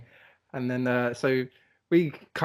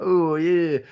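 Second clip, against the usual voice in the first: high, loud blokey drawl; scumbag London guy voice; 5 semitones higher, 7 dB louder than usual. Retold or imitated. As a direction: do her as a dodgy London lad. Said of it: "turning her into some scumbag London guy".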